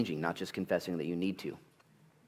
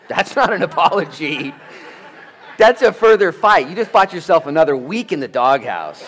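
high, very loud comic rebuke; mock outraged shout; 9 semitones higher, 15 dB louder than usual. Preacher calling out a husband's bad apology, shouted in mock outrage. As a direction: holler it like a scandalized referee.

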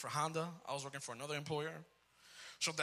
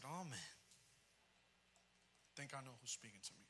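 much softer, quick hushed aside; quiet realization; 5 semitones lower, 16 dB quieter than usual. Retold or imitated. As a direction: mutter it, realizing. Realizing voice was God.

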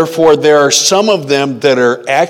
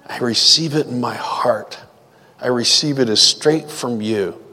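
prayer. Acted. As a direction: pray it gently to the Lord. Leads a prayer.

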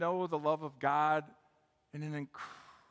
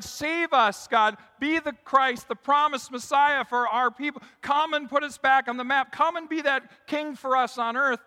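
high, loud pleas in a preacher's voice; retold crowd shouting; 9 semitones higher, 11 dB louder than usual. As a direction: cry out as the crowd begging for rescue. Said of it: "They were shouting".

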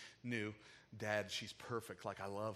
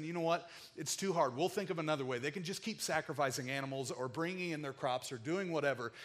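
higher, louder, exasperated declaration; loud giving up; 4 semitones higher, 5 dB louder than usual. Pretend. Preacher voicing God loudly declaring it's too hard. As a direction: proclaim it boldly to the church.

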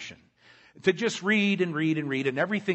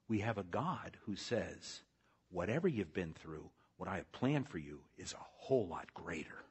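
much softer, lower, intimate sermon voice; hushed preaching; 4 semitones lower, 9 dB quieter than usual. Acted. Pastor quietly comforting the suffering congregation. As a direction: bring them in close and speak it gently.

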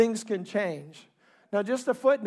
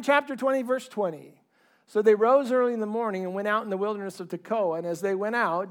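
usual speaking voice

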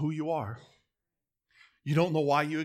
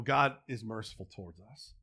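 ordinary talk, nothing marked

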